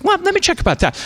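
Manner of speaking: a mock therapist voice, pitched high. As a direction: play the therapist with a high, breezy voice. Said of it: "and she's like".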